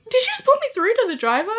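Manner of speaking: high, fast indignant voice; outraged caller. Retold or imitated. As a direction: squeak it out in disbelief. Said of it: "the girl's like".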